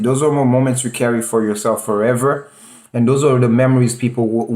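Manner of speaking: ordinary delivery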